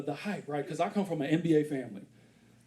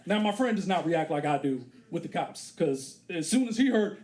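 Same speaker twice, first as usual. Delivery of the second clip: ordinary talk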